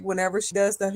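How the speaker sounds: usual speaking voice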